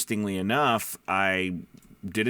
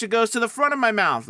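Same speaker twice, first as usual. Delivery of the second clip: much higher, pushed to the front of the mouth; high pitch; 13 semitones higher, 5 dB louder, faster than usual. Shown as a demonstration. Voice coach demonstrating his high placement. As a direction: pop it up high and forward. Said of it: "And when I high pitch".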